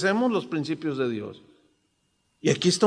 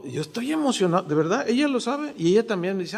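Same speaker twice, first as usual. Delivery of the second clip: ordinary talk, nothing marked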